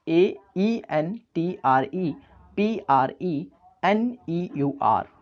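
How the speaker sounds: letters dictated slowly; slow spelling. Shown as a demonstration. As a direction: spell it out for the class. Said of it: "spelling a word aloud". (धीरे-धीरे अक्षर गिनाना)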